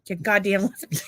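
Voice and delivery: shouted through a laugh; laughing mock insult. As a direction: fake insult, cracking up.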